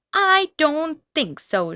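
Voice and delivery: high, loud, slow defiant shout. A narrator's reading. Third pig defying the wolf, shouting high and loud. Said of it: "shouted the third Super Pig".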